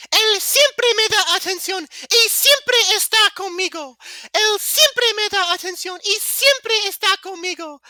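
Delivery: put-on loud, very high falsetto girl. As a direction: gush in an exaggerated girly squeal. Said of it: "I'm doing the voice".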